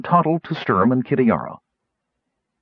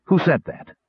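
raised-pitch challenge; higher-pitched, mild call; 8 semitones higher than usual. A narrator's reading. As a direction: call out sharply to an unseen speaker. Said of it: "called Sturm".

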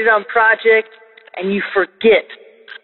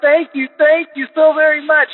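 mock tearful thank-yous, pitched higher; imitating tearful thanks; 7 semitones higher than usual. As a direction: voice the brother's crying gratitude, lifted high. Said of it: "he just starts crying".

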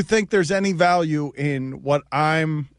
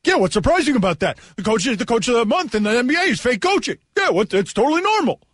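sarcastic fast mock voice; 6 semitones higher, 3 dB louder, faster than usual. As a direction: sneer it, mocking his view. Mocking the other guy's view.

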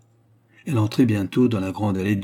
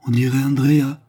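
cri d'étonnement; 4 semitones higher, 6 dB louder than usual. A narrator's reading. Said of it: "jeta un cri d'étonnement".

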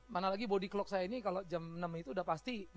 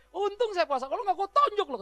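louder and higher, eager to punch; mock angry threat, pumped up; imitating a fired-up threat; 9 semitones higher, 7 dB louder than usual. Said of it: "mukanya serius banget, udah semangat". (ancaman pura-pura marah, bersemangat)

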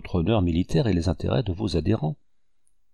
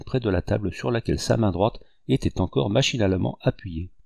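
plain reading voice

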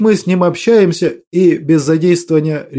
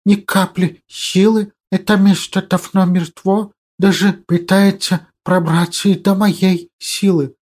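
hissing creature voice. Acted. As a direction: hiss like a small monster. Hissing creature sidekick speaks.

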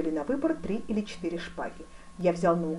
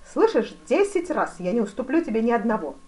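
insistent; 5 semitones higher, 7 dB louder than usual. A narrator's reading. Duke insists while attacking. (настойчиво и громко)

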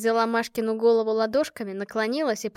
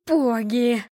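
louder, disgusted outcry; shocked exclamation; 2 semitones higher, 7 dB louder than usual. A narrator's reading. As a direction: exclaim as the drink hits you. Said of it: "воскликнула она, закашлявшись". (потрясённое восклицание)

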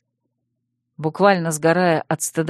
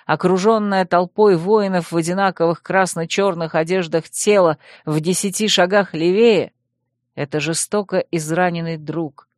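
usual speaking voice

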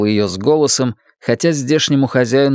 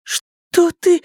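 put-on high, scared child voice; a scared boy asking; frightened boy. Frightened Jake.

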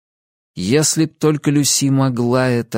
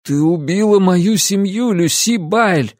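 higher, slowing on the name; anguished accusation; 7 semitones higher, slower than usual. A narrator's reading. Ansel accusing Lucy of killing his family, anguished. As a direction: hurl the charge at her.

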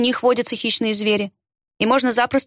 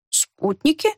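puzzled question; 4 semitones higher, slower than usual. A narrator's reading. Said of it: "переспросил Бральт".

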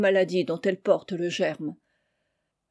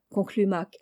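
usual speaking voice

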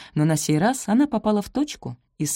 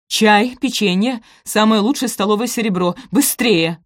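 loud, raised rapped-out orders; commanding; 4 semitones higher, 6 dB louder than usual. A narrator's reading. Stepmother orders the butler about, commanding. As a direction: command the servants urgently. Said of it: "скомандовала она".

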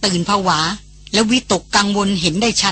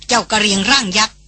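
plain reading voice

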